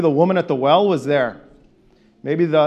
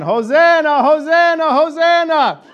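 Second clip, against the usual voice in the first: the loudest, higher triple shout; as loud as possible; 10 semitones higher, 5 dB louder, slower than usual. Shown as a demonstration. Urging the crowd to its loudest, full shout. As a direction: give it everything you've got. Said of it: "now as loud as you can".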